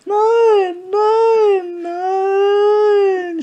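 high, loud, panicked stammered no's; stammering. Acted. Detlef stammering at Dieter who wants a fight, high and frantic. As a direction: stutter out the denial in a high panic. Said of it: "stotterte Detlef".

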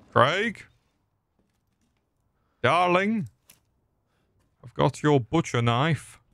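high questioning call; playful searching call. Pretend. Calling for missing Craig in game.

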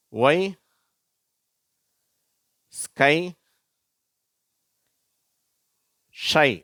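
loud, isolated words with long gaps; emphatic teacher's word list; repeat-after-me word reading. Shown as a demonstration. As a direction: model each word for learners, pausing for repetition. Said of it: "அந்த வார்த்தைகளை நான் வாசிக்கிறேன்".

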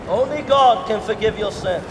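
normal talking voice

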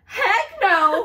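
high, loud laughing yell; scared shout. Real, not acted. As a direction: yell it, half laughing in fright. Frightened outburst at scary scene.

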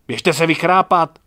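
angry retort. A narrator's reading. Young Gajdošíková scolding Čmelda at midnight, angry. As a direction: send the drunk off to sleep it off. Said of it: "odvětila mladá Gajdošíková".

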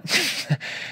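short breathy laugh. Real, not acted. Preacher reacts to Jesus' reply.

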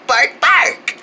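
dog bark. Shown as a demonstration. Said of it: "Let's say bark noises like a dog".

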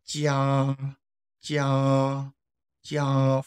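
JIA held high and level; long, steady high JIA; first tone. Shown as a demonstration. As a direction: sustain JIA on one high pitch. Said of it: "first tone".

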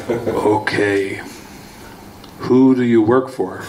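low, even question from a captor; calm interrogator. Retold or imitated. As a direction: ask it coolly, like a patient interrogator.

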